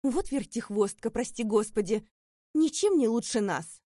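put-on loud, sneering old woman's scolding; unpleasant voice. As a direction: spit out the judgment with contempt. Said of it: "неприятным голосом".

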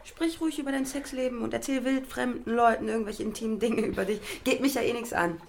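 high, bitter and mocking; sarcastic bitter rant; bitter sarcasm. Real, not acted. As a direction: sneer it at her, hurt. Hurt partner, sarcastic outburst.

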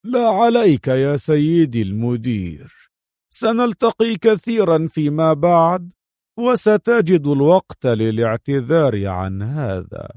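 lightly voiced guest brushing off an apology; unbothered Morris. A narrator's reading. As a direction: grant pardon with a knowing air. (نبرة متسامحة غامضة)

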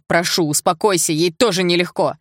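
loud pleading, pitch kept level; shouted plea. A narrator's reading. Igor holding Polina back from Sasha, shouted plea. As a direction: urge her loudly to stop. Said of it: "закричал Игорь".

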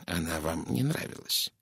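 soft, low-key probing; gentle. A narrator's reading. Poirot probes the witness about the dead woman, gently. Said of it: "мягко произнёс Пуаро".

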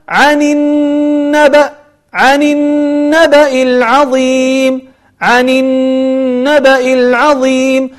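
slow chant, long nasal hum on the noon of النبأ; ghunnah. Shown as a demonstration. Teacher showing how to read the verse, nasalised noon held long. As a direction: hold the doubled n in النبأ through the nose. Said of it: "مشددة وفيها غنة".

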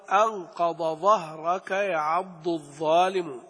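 slow, each letter of أنقض ظهرك sounded distinct; clear ض and ظ. Shown as a demonstration. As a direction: keep ض in أنقض apart from ظ in ظهرك. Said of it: "لا تقلب الضاد ضاء ولا الضاء ضادا". (نطق واضح للضاد والظاء)

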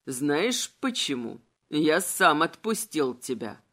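low, persuasive villain voice; insinuating villain incarnate. A narrator's reading. Incarnate urging Adel to accept her dark side, low and insinuating.

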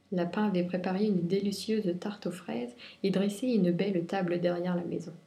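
usual speaking voice

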